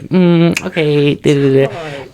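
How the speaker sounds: high, sing-song mock-girly reply; bubbly girl voice. Retelling the girl's friendly chatter, high bubbly imitation. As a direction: imitate a girl happily going along. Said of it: "like, so bubbly, so kind".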